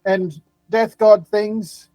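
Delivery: breezy dismissive professor. Acted. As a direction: toss it off lightly as you leave. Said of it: "He's like".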